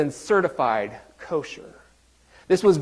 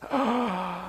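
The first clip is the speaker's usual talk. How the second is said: mock gasp; 5 semitones higher, 7 dB quieter than usual. Pastor reacts to kosher mark.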